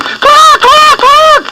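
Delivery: hen screaming. Acted. Mother hen screaming in alarm for her chick. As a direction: squawk like a frantic mother hen. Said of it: "and she screamed".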